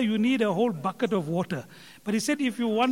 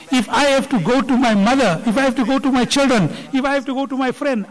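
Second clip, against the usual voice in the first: impassioned; 2 semitones higher, 8 dB louder than usual. Acted. Passionate pleading in sermon story.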